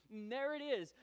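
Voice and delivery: usual speaking voice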